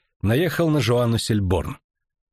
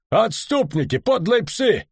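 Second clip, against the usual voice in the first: rising into a much higher furious yell; 11 semitones higher, slower than usual. A narrator's reading. Red-faced officer keeps yelling insults at the deserters. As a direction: curse them as they ride off. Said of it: "крикнул Дакр, краснея как вишня".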